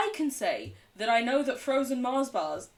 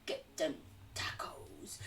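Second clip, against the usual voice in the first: slow, whispered American cowboy voice; Kentucky cowpoke accent; 6 semitones lower, 11 dB quieter, slower than usual. Acted. English streamer faking an American, whispered Kentucky cowpoke accent. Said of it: "like a Kentucky horse riding cowpoke".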